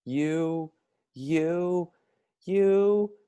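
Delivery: building from high to a loud furious shout, slow; angry shout. A narrator's reading. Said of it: "he shouted".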